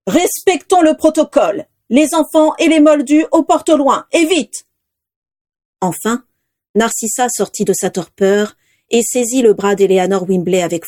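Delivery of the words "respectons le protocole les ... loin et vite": higher, raised commanding voice; powerful command. A narrator's reading. Headmaster orders children to the far gates, powerful urgent command. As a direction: urge the crowd on with authority. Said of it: "la voix puissante de Dumbledore".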